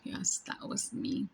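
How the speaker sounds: hushed, low, almost whispered; gentle fatherly reassurance, very quiet; tender reveal. Retold or imitated. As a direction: drop to a soft whisper, pausing between phrases. Jesus tenderly reveals himself to her.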